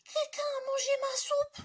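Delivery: very high, squeaky, tearful cub; baby bear crying over his eaten soup; crying tiny bear. Acted. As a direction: wail it like a tiny bear in tears. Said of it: "se mit à pleurer".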